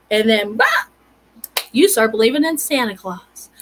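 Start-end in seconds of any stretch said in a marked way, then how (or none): none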